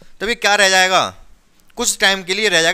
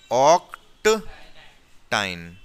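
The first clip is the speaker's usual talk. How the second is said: very slow, long gaps between the parts; 7 semitones lower, much slower than usual. Real, not acted. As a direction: piece the name together slowly for students. Teacher builds the name in parts.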